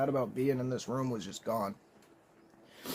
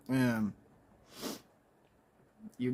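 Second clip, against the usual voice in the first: ordinary talk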